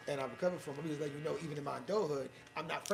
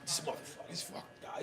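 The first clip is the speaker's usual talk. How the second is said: muttered curse; 10 dB quieter than usual. Retold or imitated. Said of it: "he was like".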